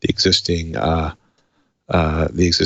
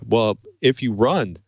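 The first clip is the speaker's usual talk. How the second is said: normal talking voice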